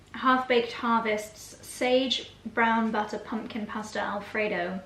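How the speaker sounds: ordinary talk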